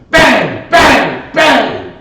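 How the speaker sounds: three loud sharp bangs, each falling; gunshot bangs. Retold or imitated. Narrator acting out the shooting of the woman, loud bangs. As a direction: bark out the shots one by one.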